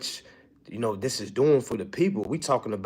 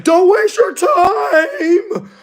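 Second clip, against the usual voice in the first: much higher, louder and very slow, a whiny wail; mock the trolls with a hammed-up crying shout; 9 dB louder, much slower than usual. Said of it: "get on here and troll, like".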